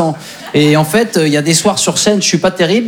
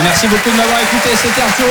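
higher radio host voice; mock-announcer outro, pitched up; announcer sign-off; 7 semitones higher than usual. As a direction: announce his own name like a DJ.

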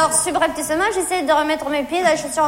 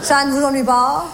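Jean-Loup; 6 semitones lower than usual. Retold or imitated. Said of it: "tu sais ce qu'il me dit?".

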